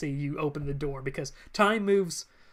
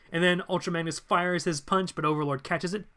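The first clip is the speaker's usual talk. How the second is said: plain reading voice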